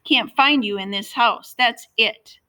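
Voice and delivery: high, loud angry shout. A narrator's reading. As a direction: shout it, patience gone. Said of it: "Carter was shouting now".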